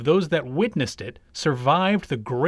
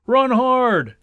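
loud, high rallying cry; cheering shout; 8 semitones higher, 6 dB louder than usual. A narrator's reading. Supporters of the tortoise urging him on, shouting. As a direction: cheer your runner on at full voice.

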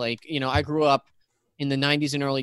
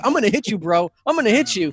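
mock bro threat; 6 semitones higher than usual. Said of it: "the one guy's just like".

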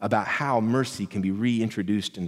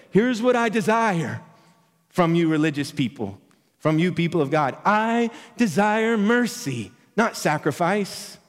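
voice of God; 7 semitones higher, 4 dB louder than usual. Acted. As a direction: proclaim it like God speaking from on high. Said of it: "that God said".

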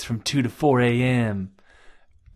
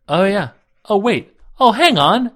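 dawning realization; 9 semitones higher, 6 dB louder than usual. Retold or imitated. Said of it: "I was like".

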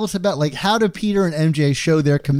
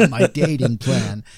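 lower, hammed-up schemer; mock villain; 6 semitones lower than usual. Host repeating his dating plan for laughs, mock villain. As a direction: relish it like a pretend villain.

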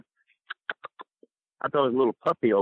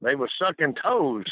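ordinary delivery

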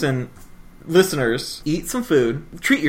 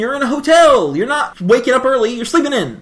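emphatic; 8 semitones higher, 3 dB louder than usual. Real, not acted. Emphatic mock outrage on breakfast.

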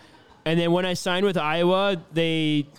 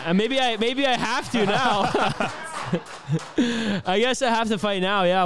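laughing; 6 semitones higher than usual. Real, not acted. Laughing about being dared to fight.

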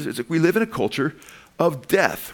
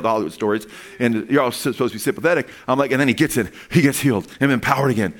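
ordinary talk, nothing marked

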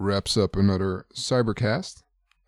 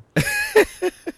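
chipmunk laugh; 4 dB louder than usual. Real, not acted. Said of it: "a chipmunk driver".

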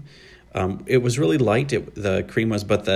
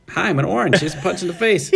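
much higher, louder voice of a cartoon orange; a bold orange introducing itself, hammed up; talking orange; 10 semitones higher, 3 dB louder than usual. Voicing the orange flavor.

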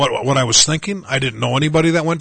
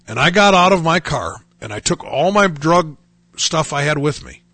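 normal talking voice

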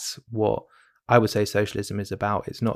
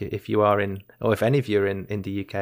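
ordinary delivery

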